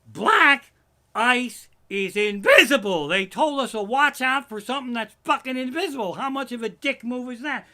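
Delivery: high, loud furious rant; furious shout. Real, not acted. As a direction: explode at the weather warning.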